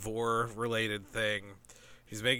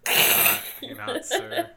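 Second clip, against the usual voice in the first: laughing; 14 semitones higher, 8 dB louder than usual. Real, not acted. Joking protest among friends.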